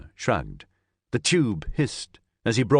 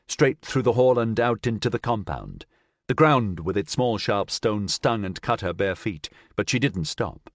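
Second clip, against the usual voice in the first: plain reading voice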